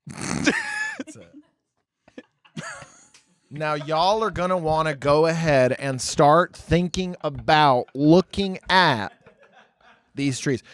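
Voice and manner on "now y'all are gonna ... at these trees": slow, deliberate, long-winded Southern guide; drawn-out Southern preamble. Retold or imitated. Southern guide preambling to tourists, slow drawn-out delivery. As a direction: stretch every phrase, pausing before the last words. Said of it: "they preamble what they're saying so much".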